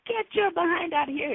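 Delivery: high, urgent mock yell; retold yelling. Woman yelling at a demon in the shadows, acted high yell. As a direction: order it out, pitch jumping way up. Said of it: "I am yelling at this demon".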